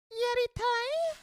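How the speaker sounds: very high, slow squeaked Yaritai; mock squeaky take. Shown as a demonstration. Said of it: "That probably ruins it".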